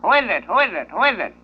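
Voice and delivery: high squawky parrot repeating itself. Acted. Parrot in the joke squawking at the plumber knocking at the door, high and repetitive. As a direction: play the parrot, pitched well up and chirpy.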